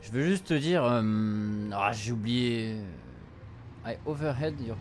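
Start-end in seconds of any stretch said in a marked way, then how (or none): none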